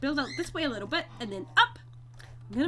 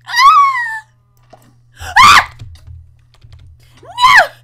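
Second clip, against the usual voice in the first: real screams; 18 dB louder, much slower than usual. Real, not acted. Block break upsets the bees.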